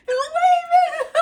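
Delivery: high, loud squeal breaking into laughter; overjoyed, laughing cry of delight. Real, not acted. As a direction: exclaim it in real joy, laughing. Delighted as pet waves.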